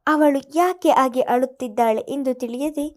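ordinary delivery

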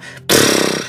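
short puff through the lips; blown-out breath. Shown as a demonstration. Guide offering listeners a sound to make, blown-out breath. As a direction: demonstrate a noisy blown exhale.

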